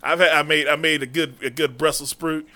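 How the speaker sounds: ordinary talk, nothing marked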